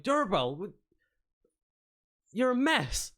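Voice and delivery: high-pitched, aghast scolding; appalled exclamation. Acted. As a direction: exclaim in disbelief at the mess. Shopkeeper appalled at her filthy state.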